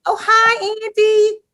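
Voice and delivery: very high, loud hello; squealed greeting. Pretend. Host greeting a musician's imaginary friend, high playful squeal. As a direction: coo it loudly, way up high.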